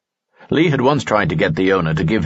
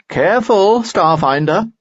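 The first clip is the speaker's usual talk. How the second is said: Lanson calls out, higher and louder; 12 semitones higher, 4 dB louder than usual. A narrator's reading. Friend warns Lee from the steps, loud high call. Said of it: "said a voice from the steps".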